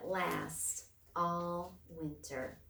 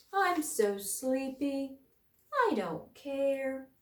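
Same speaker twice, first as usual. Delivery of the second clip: slow, higher drowsy bear voice; sleepy bear; 5 semitones higher, slower than usual. A narrator's reading. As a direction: yawn the words out.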